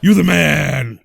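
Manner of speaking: hype shout. Pretend. Hyping returning host.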